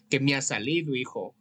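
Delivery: normal talking voice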